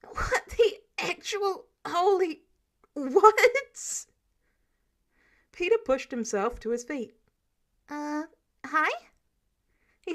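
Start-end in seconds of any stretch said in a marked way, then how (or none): 0.0-4.1 high, loud and slow disbelief; shocked yell. Acted. Clint shocked by stowaway. 7.9-9.1 put-on sheepish teen. Peter caught on ceiling.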